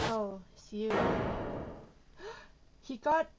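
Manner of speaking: ordinary talk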